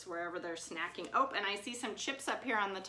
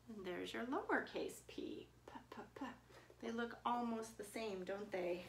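soft whispered aside; 8 dB quieter than usual. Real, not acted. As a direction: whisper it to yourself while writing.

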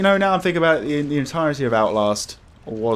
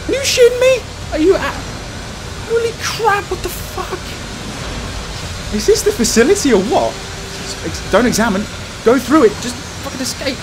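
scared horror game streamer; 14 semitones higher than usual. Real, not acted. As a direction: panic as the killer closes. Chased and stabbed, panicking.

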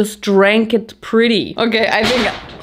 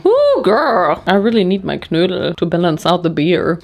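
usual speaking voice

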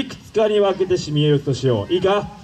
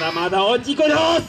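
fast, higher shout, "おー" pushed up; building shout; 5 semitones higher, much faster than usual. Acted.

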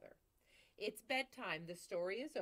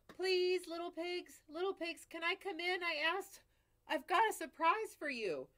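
higher, louder sweet-talking wolf; polite wolf; 11 semitones higher, 10 dB louder than usual. Acted. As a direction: coax the pig with overdone politeness. Wolf asks at the door.

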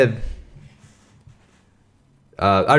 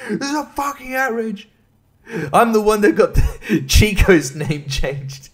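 mock outrage; 8 semitones higher than usual. Continuing mock outrage bit.

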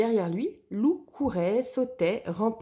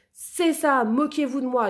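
high, loud furious outburst; angry yell; 5 semitones higher, 6 dB louder than usual. Acted. Lou exploding at Louve and Gros Louis, furious yell. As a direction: explode at them in anger. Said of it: "hurla-t-il, très en colère".

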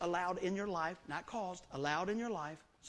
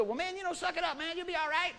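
high, careless mock brush-off; mocking dismissive friend; 10 semitones higher, 3 dB louder than usual. Imitating his old self to a hurting friend, careless brush-off. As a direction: toss it off like you don't care. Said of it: "I didn't just go".

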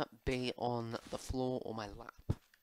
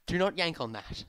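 higher, louder mock scolding; scolding a pet; 6 semitones higher, 5 dB louder than usual. Player recounting telling off Rexy for pulling the cord, scolding. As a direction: tell off the pet firmly.

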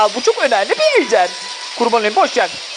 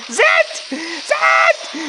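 calling out; 11 semitones higher than usual. Real, not acted. Calling out teammate.

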